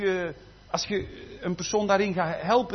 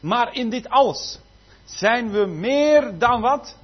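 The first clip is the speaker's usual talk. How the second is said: louder, slowing to a drawn-out prompt; call and response; 4 semitones higher, 7 dB louder, slower than usual. Acted. Preacher prompting the congregation to finish the verse, loud. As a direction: leave the line hanging for the church.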